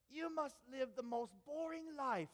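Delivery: drawn-out British accent, mocking. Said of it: "I'm not gonna do the British accent anymore".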